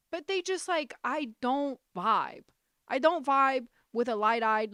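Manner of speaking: ordinary delivery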